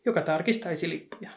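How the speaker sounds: usual speaking voice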